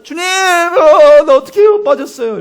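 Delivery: very high, loud, stretched desperate cry; panicked cry for help. Retold or imitated. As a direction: scream to the Lord as you sink. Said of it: "살려달라고 부르짖었을까".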